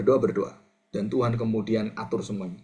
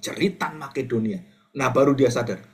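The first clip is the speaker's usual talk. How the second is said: ordinary talk, nothing marked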